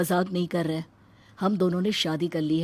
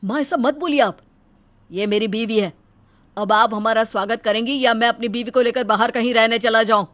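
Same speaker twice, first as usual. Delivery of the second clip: loud, higher protest to mother; angry son; 3 semitones higher, 10 dB louder than usual. Acted. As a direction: defend your wife, loud and firm. Son defends wife.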